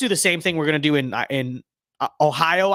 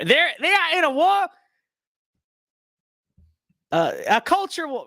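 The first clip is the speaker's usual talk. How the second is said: excited; 12 semitones higher than usual. Pretend. Dramatic campaign attack line.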